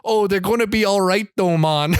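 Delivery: amused imitation of a fake Jamaican accent. Host mimicking celebrity's covid announcement, bad Jamaican accent. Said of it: "with his bad Jamaican accent going".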